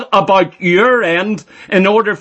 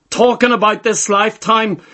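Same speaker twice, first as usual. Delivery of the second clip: usual speaking voice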